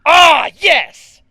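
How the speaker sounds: high, loud triumphant cry; excited shout. Real, not acted. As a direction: cheer like your team won. Cheering good news.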